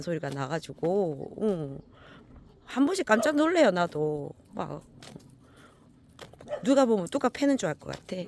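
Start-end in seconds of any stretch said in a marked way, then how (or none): none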